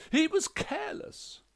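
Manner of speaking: very high, loud; aggrieved ghost wife. A narrator's reading. Ghost wife complains to the detective, high and loud. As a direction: fling out the accusation, pitched high.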